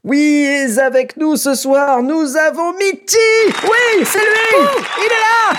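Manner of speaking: excited shriek. Real, not acted. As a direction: shriek with joy at a guest. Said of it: "les gens ont saturé, euh, là, en hurlant".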